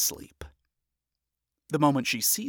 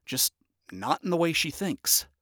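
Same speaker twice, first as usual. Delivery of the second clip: ordinary delivery, nothing marked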